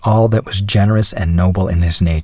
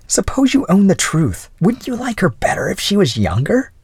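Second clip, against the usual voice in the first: much higher, teasing insolence; goading young lady; 12 semitones higher than usual. Acted. Young lady provoking a married man, high goading voice. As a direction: needle him as a saucy young woman.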